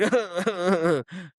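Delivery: high mock coughing fit; imitated cover-up coughing. Kids covering up a grunt, mock coughing. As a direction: fake a burst of coughing. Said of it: "they start coughing to cover that up".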